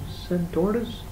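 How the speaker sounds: ordinary delivery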